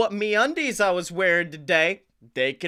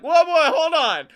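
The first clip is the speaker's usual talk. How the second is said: retold exclaim; 9 semitones higher, 5 dB louder than usual. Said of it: "And I'm like".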